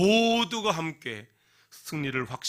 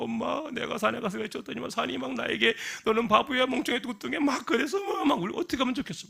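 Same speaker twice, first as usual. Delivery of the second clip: high whining complaint to mom; upset child; 11 semitones higher than usual. Acted. Upset girl complaining to her mother, high and whiny. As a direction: tattle to mom, hurt and high. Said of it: "분해서 집에 내려와서 엄마한테".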